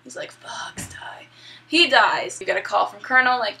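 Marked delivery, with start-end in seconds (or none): 0.3-1.3 whispered last words; hushed, hammed-up death whisper; mock dying gasp. Acting out Liquid's dying words.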